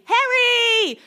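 high-pitched, drawn-out answering call; hammed-up cry. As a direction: call back melodramatically, up high. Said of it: "cried Ron".

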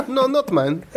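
high mock Polish accent. Carpenter disowning a tiny toy lady, high Polish accent. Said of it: "this is actually another Polish gentleman".

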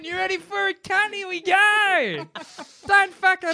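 very high, hammed-up ocker threat; mock aggressive yelling. Aggressive surgeon asking if the patient is ready, mock yell. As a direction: goad them in a strained high voice. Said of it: "that guy is very aggressive".

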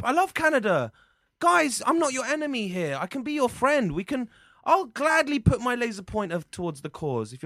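high-pitched eager pleading; keen, placating friend-making; eager ingratiation. Acted. Pleads to befriend the Canadian pranksters.

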